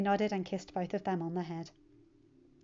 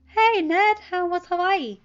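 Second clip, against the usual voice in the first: put-on high, louder, bright-eyed teen voice; cheerful teen; 10 semitones higher, 11 dB louder, slower than usual. Teen telling his uncle about his weekend, cheerful and high. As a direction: bounce through it like an eager kid.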